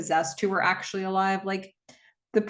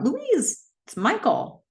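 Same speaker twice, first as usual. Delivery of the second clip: higher-pitched mock call from another room; half-acted call across the house, pitched up; retold shout; 12 semitones higher, slower than usual. Recapping a horror film, voicing a shout to Louise, mock call. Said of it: "she hears someone shout".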